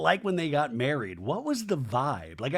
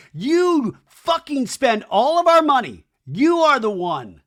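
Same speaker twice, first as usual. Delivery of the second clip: loud, high, slow accusation; imitated shouting, words drawn out; mock-furious yelling; 9 semitones higher, 9 dB louder, slower than usual. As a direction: act out an enraged ex shouting blame. Retold screaming fight about money.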